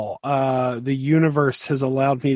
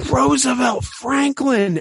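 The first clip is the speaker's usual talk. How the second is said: high, slow amazed gasp of a name; awestruck; 11 semitones higher, slower than usual. Retold or imitated. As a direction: breathe the name in wonder. Awed at seeing puppet.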